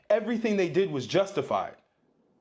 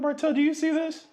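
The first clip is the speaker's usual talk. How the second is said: much higher mock voice of dad; dad calling his son over in a much higher mock voice; imitating dad; 12 semitones higher than usual. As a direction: squeak it up high, hamming up the incredulous father. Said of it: "he'd be like".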